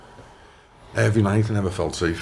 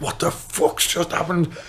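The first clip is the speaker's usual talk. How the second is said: high, slow startled cry; panicked shout jumping up off the bed; mock panic, voice leaping high; 12 semitones higher, slower than usual.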